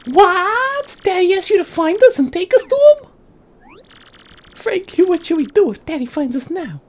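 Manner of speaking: very high falsetto gangster's daughter; squeaky-high, indignant then worried; feisty runaway daughter. Acted. Daughter confronts her father's messenger, very high indignant falsetto.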